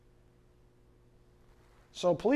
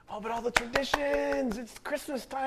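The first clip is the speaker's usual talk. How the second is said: pine sentimentally in a put-on high voice; mock lament; 6 semitones higher, 6 dB quieter than usual. Pastor mimicking lovers of Christmas traditions, mock wistful lament.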